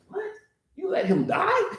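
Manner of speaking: very high, stunned question; can't believe it; shocked disbelief. Acted. Disciples shocked that Jesus let Lazarus die, very high.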